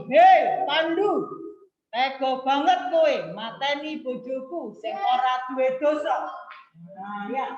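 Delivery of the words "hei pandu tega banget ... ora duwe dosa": loud HEI, then demonstrated anger; angry demo. Shown as a demonstration. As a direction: model an angry person's accusation for pupils. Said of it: "Ini kan orang marah ini".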